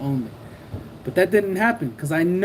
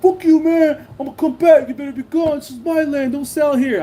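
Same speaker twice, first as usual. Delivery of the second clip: high, heated mock yelling; retold angry threat; 9 semitones higher, 5 dB louder than usual. Rival warning seller off his land, high angry imitation.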